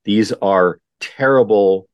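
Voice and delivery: ordinary talk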